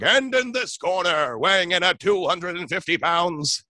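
slow, booming ring announcer; fight announcer voice. Shown as a demonstration. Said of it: "an announcer fighting voice".

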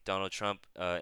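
ordinary talk, nothing marked

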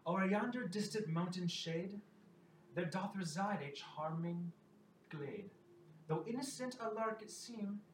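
recited verse. Acted. Minstrel delivers a prophecy song to the travelers, recited verse. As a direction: declaim each rhymed line, measured and rising.

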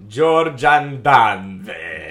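put-on loud, very slow Maltese accent. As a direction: drag George and Danvers out Maltese-style. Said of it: "making fun of my Maltese accent".

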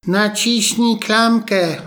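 put-on high, slow old-woman voice; weak old grandmother. Bedridden grandma to the visitor at the door, in a high frail voice. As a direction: sound frail, too weak to get up. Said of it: "rzekła babcia".

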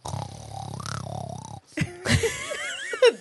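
deep, raspy pig snort. Acted. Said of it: "Was that a pig or The Grudge?".

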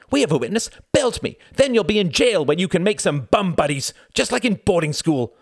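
very high, loud goading; defiant taunt. A narrator's reading. Wendy daring Reginald to hit her, taunting. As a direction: dare him at full pitch.

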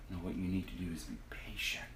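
quick whispered correction; fast whisper. Real, not acted. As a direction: hush the impatient thought, quickly. Correcting desperate voice gently.

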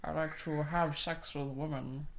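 lightly voiced Connery as Bond, slow; Sean Connery impression. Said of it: "Sean Connery".

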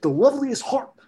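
high-pitched goofy celebrity impersonation; high, swooping impersonation; Andy Samberg. Acted. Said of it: "I do an Andy Samberg impersonation".

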